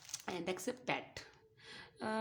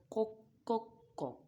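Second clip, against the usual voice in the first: rooster call; 3 semitones higher than usual. Pretend. Imitating a rooster for kids.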